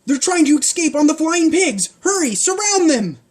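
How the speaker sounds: high, loud alarmed guard; high shrill guard yelling orders; guard shouting. Acted. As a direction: raise the alarm.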